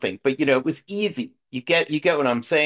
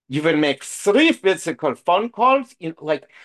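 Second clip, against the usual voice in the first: put-on stern caricature with a thick German accent; mock German accent; 4 semitones higher, 5 dB louder than usual. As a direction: bark rules in a hammed-up German accent. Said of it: "it's always in this kind of accent".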